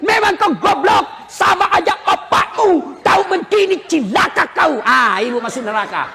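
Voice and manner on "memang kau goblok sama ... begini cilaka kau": mock angry insults, slow and drawn out; hammed-up wife cursing her husband; wife swearing at her husband, half-acted; abusive wife cursing. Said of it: "yang tidak boleh maki-maki suami".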